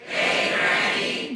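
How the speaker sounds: breathy slow whisper, dragged out; whispered cue. Shown as a demonstration. Comedian cues the crowd, slow whisper. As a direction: audience prompted to whisper hey granny.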